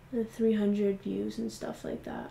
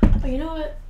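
much louder, higher attention-grab; punchy hook; 6 semitones higher, 10 dB louder than usual. Real, not acted. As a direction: throw it out loud to the camera. Sudden topic change hook.

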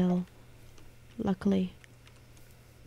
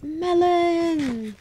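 delighted; 6 semitones higher, 6 dB louder than usual. Real, not acted. Delighted at melon field.

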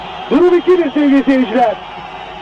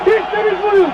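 ecstatic goal shout; 8 semitones higher, slower than usual. Real, not acted. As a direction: scream the goal with joy. Goal call in live commentary.

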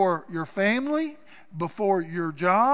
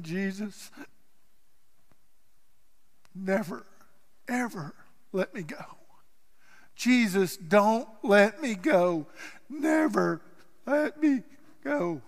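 softer, halting plea through tears; tearful plea; 4 dB quieter than usual. Acted. Peter in the boat pleading with Jesus, softly tearful. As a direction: beg through tears, breaking up. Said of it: "through the tears".

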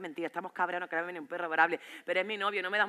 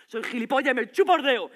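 higher, much louder repeat; shouted sarcasm; 4 semitones higher, 8 dB louder than usual. Acted. Boyfriend accuses her over a call, shouted sarcasm. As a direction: explode with sarcastic anger.

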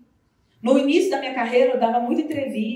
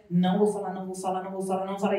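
muttered to herself; 5 semitones lower, 5 dB quieter, faster than usual. Retold or imitated. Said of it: "não podia ficar falando muito".

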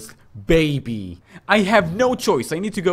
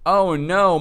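ordinary talk, nothing marked

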